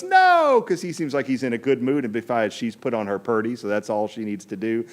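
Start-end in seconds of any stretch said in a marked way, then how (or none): 0.0-0.6 a sudden, very high, loud "No"; delighted shout of no; eager high no. Acted. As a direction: squeal it out like a smitten king. King's eager reply.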